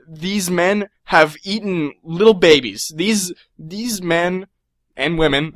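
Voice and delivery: slow, weighty mock-menacing list; grave build-up. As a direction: draw out each boast about fearsome henchmen. Building up how hardcore guards are.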